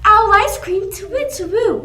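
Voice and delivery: high, loud startled hoot; shocked owl. Acted. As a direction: gasp it out like a frightened owl. Owl hears scary menu.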